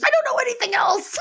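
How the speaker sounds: high, hammed-up desperate cry; exasperated wail. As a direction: wail it in mock despair, fast and high. Acted quote, exasperated woman defending her accent.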